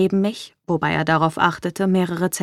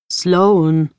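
louder rough warning on the name; 6 dB louder than usual. Acted. Lucian warns Sloan by saying her name, rough. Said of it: "Mein Name klang rau, wie eine Warnung".

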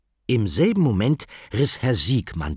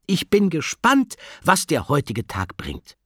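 teacher voice; 9 semitones higher, 4 dB louder than usual. Acted. Teacher opens the day before her class, high teacher voice. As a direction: announce it keenly to the whole class.

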